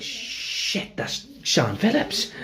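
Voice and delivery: hushed start leaping high in disbelief; starstruck gasp. Retold or imitated. Extra spotting a famous actress on set, starstruck. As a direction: whisper the swear, then slow, high amazement.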